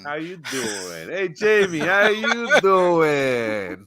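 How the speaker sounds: exaggerated sitcom catchphrase, very slow; Joey. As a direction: stretch the greeting into a hammed-up imitation. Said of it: "Joey from The Friends".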